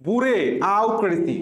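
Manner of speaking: "বুড়ে", pause, "আউ ক্রেসি"; memory-trick pronunciation. Shown as a demonstration. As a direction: split it as boo-ray, then au-cracy. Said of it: "তাহলে আমি কিভাবে উচ্চারণ করছি".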